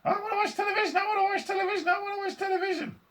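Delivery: put-on rapid-fire, piercing tiny-boy chant; very high squeaky demand; screaming tantrum. A narrator's reading. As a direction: shriek it over and over. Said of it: "screaming and yelling".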